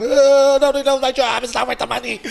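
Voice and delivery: high-pitched crying wail, retold; mock crying. Shaman retells waking up crying before work, mock crying. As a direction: act out a tearful high whine. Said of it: "I'm crying".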